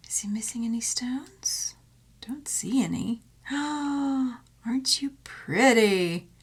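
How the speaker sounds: hushed admiring whisper, slow. Real, not acted. Whispering in awe over a dragonfly pendant. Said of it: "admiring a dragonfly pendant".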